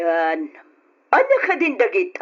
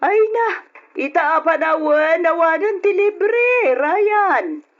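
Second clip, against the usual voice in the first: scolding aunt; 8 semitones higher than usual. Acted. Aunt scolds nephew over salary.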